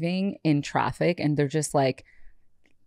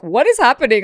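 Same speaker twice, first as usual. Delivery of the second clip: louder, higher baffled question; retold alarmed bewilderment; 5 semitones higher, 8 dB louder than usual. Driver seeing his eyes in the mirror, alarmed disbelief. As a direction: raise it in mock alarm. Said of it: "I'm just like".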